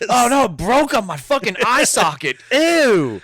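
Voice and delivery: high, loud mock wail; hammed-up squeal of disgust; mock disgusted victim. Friend playing the man hit by a jar, hammed-up high disgust.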